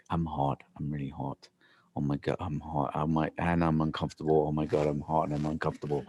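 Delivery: low, hushed inner monologue; muttered inner complaint. Retold or imitated. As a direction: fret quietly to yourself, kept low. Acting out mounting inner panic.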